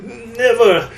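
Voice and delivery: high, sharp defiant cry; defiant refusal. Acted. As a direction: refuse him with heroic pride. Bill defiantly refusing Thor.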